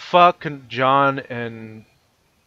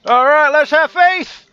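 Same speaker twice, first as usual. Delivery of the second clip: excited yell; 14 semitones higher, 7 dB louder, slower than usual. Real, not acted. Jumping off with a yell.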